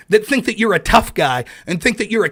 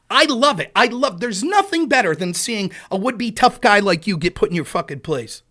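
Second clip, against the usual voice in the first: ordinary delivery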